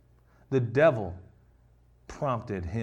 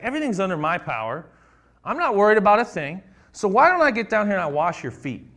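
higher, louder, breezily sure of himself; so confident; 7 semitones higher, 6 dB louder than usual. Retold or imitated. Pastor voicing Jesus at the table, higher, louder and supremely confident. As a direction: toss it off like nothing can worry you. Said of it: "like, so confident".

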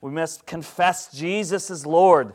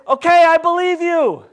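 high, loud acted reply; calling back; 11 semitones higher, 7 dB louder than usual. Person in the pit answers the rescuer, calling back loudly.

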